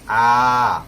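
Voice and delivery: आ held loud and long, mouth wide open; fully open vowel. Shown as a demonstration. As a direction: open right up and belt out a sustained आ. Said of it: "मुंह पूरा खोलेंगे". (पूरा मुंह खोलकर ज़ोर से लंबा आ)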